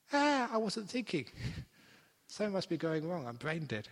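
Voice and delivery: high, loud mock panic with a laugh; mock terror. New meditator panicking about silence, high and loud mock panic. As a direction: ham up a panicking beginner. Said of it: "now they get terrified".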